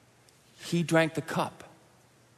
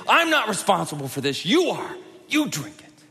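accusing; 8 semitones higher, 6 dB louder than usual. Pretend. Jesus blaming us, hypothetically.

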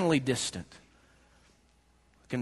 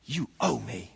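muttered demand; 4 semitones lower, 5 dB quieter than usual. Acted. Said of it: "what he's saying without saying it".

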